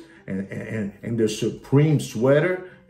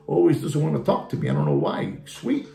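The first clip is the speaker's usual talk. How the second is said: ordinary talk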